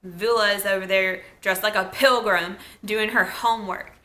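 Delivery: ordinary delivery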